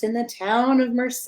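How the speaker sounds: ordinary delivery, nothing marked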